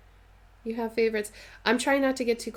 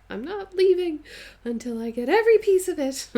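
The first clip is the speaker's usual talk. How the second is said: higher, louder hammed-up insistence; mock dramatic demand; 10 semitones higher, 8 dB louder than usual. Telling her viewers she'd never leave without the full set, hammed-up mock shout. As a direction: overplay the greedy shopper for laughs. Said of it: "I'm just being dramatic to be funny".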